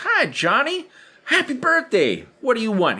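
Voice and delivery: high, sugary shop-lady greeting; friendly counter lady. Retold or imitated. Ice cream lady greeting Johnny, high and sweet. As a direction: greet the birthday boy brightly. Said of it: "the lady behind the counter goes".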